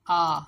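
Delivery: आ loud and long as "aah"; vowel sound demo. Shown as a demonstration. Teacher shows only the vowel sounds, loud आ. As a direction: give आ firmly, set apart. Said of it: "आ की sound देगा सिर्फ".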